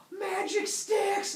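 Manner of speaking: high, drawn-out shout of wonder; excited. Acted. Humans see fire from sticks, excited shout. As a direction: shout it in thrilled amazement. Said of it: "they get all excited".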